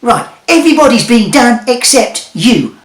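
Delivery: high, loud hammy wizard. Acted. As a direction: boom it like a showman.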